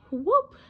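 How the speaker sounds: loud, high whoop; a sudden loud whoop as he shoots up. A narrator's reading. Boy shoots up the pipe.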